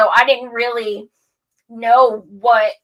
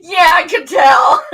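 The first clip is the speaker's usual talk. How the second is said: high loud laughing jab; mock put-down, laughing; 7 semitones higher, 8 dB louder than usual. Imagined guest mocking her, high laughing jab. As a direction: laugh through the imagined tease. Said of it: "expecting him to be like".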